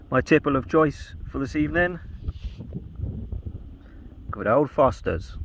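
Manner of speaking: hammed-up Australian accent, deliberately bad; terrible Australian accent. Vlogger toasting his evening beer in a bad Australian accent. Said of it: "That's a terrible Australian accent".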